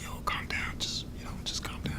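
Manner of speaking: very soft, voiceless whisper; imitating a calm lawyer whispering. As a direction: settle someone down in a whisper. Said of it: "he was so calm".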